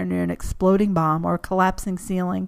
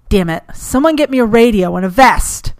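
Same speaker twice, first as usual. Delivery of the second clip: loud, sharp barked command; barked order; 3 semitones higher, 7 dB louder than usual. Acted. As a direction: bark it at the crowd around you. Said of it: "Diana barked".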